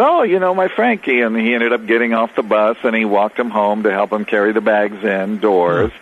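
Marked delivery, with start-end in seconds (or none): 0.0-1.2 high-pitched Italian father imitation; Italian accent. Father to his son's acquaintance, mock Italian accent. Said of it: "had a very thick Italian accent".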